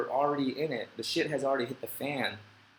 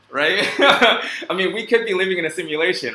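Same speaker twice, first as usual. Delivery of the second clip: laughing out the words, fast and louder; 6 semitones higher, 12 dB louder than usual. Real, not acted. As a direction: giggly rush about the simulation.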